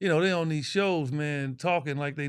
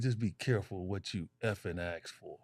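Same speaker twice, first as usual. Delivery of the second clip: lower, softer menacing warning; 8 semitones lower, 8 dB quieter than usual. Retold or imitated. Michael warning his trash-talking opponent, low menace. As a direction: threaten him quietly, lower. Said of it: "He said".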